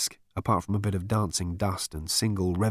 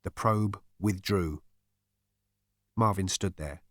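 usual speaking voice

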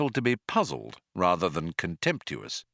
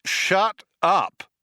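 snapping; 9 semitones higher, 5 dB louder than usual. Acted. Zaphod cutting off computer.

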